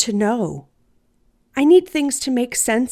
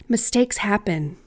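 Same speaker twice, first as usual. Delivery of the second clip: plain reading voice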